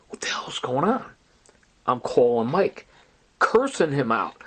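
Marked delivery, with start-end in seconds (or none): 0.1-1.1 breathy unvoiced whisper. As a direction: whisper it under your breath, baffled. Frightened inner reaction, whispered quote.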